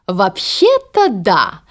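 slow, sly drawl. Acted. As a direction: say it slyly, winking. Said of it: "хитро подмигнув дочерям".

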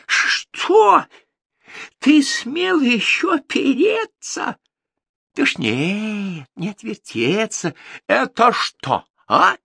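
outraged tsar. Acted. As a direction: catch him in the lie like an enraged king. Tsar catches Ivan lying.

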